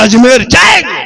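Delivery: the husband fires back, heated; hot-headed retort. Retold or imitated. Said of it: "शोहर गरम".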